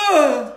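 loud high grunt of impact; loud pained ugh; retold thud grunt. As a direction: imitate the landing groan. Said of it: "she went".

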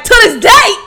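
high, loud mock boxer line, laughing; student quoting meme. Student interrupting class, high laughing mock boxer. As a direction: blurt it like the meme. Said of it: "And he's like".

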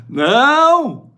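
high, loud emphatic no; loud shout. Acted. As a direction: shout it down hard. Emphatic rejection of avarice. (grito forte e agudo)

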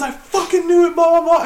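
high-pitched mock ranting; retold going off. Boss raging at laughing worker, high mock rant. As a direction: imitate the boss's tirade in a high voice. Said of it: "he starts going off".

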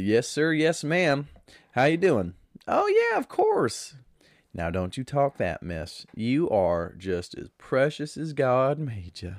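light Southern drawl, slowing at the end; a gentle Southern lady drawling; mild imitation of a classic Southern drawl. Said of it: "the classic Southern drawl".

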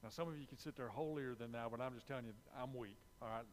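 ordinary talk, nothing marked

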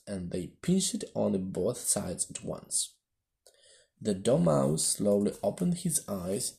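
plain reading voice

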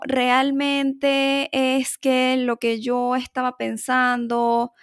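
slow, drawn-out, wavering examples; hesitant passive speaker. Acted. Passive person tentatively voicing an opinion, hesitant and slow. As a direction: hedge timidly, stretching each word out. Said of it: "Vacila mucho, ¿no? Titubea mucho".

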